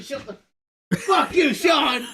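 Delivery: laughing shout. Real, not acted. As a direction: yell at him through laughter. Laughing shouting at friend Sean.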